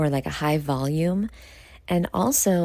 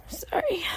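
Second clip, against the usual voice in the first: breathy sigh into a whispered sorry; tired whispered sigh; 4 dB quieter than usual. Real, not acted. Late at night, too tired to talk: whispered sigh and sorry. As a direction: exhale then whisper an apology. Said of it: "I really should be sleeping".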